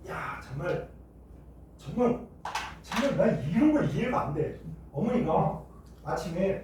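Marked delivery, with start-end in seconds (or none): none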